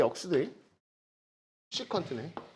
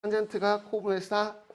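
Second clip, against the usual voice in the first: slow, sing-song memory chant; slow recitation; 3 semitones higher, slower than usual. Shown as a demonstration. Teacher giving students a rhyme to memorize, slowly. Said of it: "이렇게 외웁니다".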